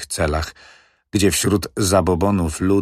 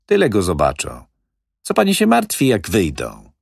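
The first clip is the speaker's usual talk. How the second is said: louder, higher, breezy wheeler-dealer; smooth-talking manager; 3 semitones higher, 4 dB louder than usual. Acted. Manager brushing off the old widow's fears, breezy and loud. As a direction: brush off her worries with swagger. Manager's long reassuring speech.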